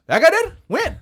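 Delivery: high-pitched, incredulous questions; imitating loud, squeaky surprise; astonished disbelief. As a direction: play a dad who can't believe it. Dad astonished at the news, retold.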